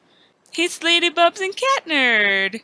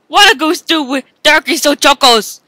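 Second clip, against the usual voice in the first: ordinary talk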